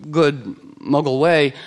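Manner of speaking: normal talking voice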